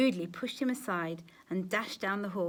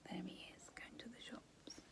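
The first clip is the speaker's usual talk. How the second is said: whisper; 21 dB quieter than usual. Real, not acted. Whispered aside to child.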